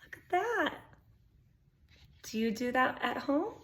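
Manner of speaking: ordinary delivery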